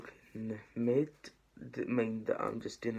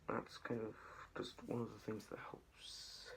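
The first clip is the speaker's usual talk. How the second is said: whispering; 11 dB quieter than usual. Real, not acted. Trailing off while focusing.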